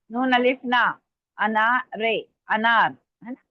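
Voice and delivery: ordinary talk, nothing marked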